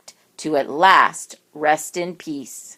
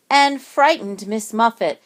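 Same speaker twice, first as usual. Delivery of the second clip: usual speaking voice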